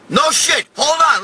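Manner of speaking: ordinary talk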